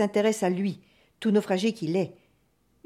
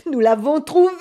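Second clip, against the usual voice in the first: loud, raised shout of discovery; triumphant cry; 5 semitones higher, 9 dB louder than usual. A narrator's reading. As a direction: shout the news to the shipowner. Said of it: "cria l'un".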